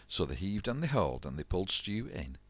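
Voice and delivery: ordinary delivery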